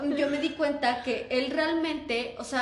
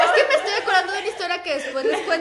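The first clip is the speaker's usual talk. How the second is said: fast, louder words through laughter; laughing; 4 semitones higher, 6 dB louder, faster than usual. Real, not acted. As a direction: rush it out while giggling. Recalling a funny story. (rápido y riendo, más fuerte)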